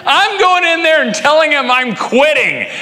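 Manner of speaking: high, loud retold yell of quitting. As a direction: shout it, fed up. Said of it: "I can remember yelling".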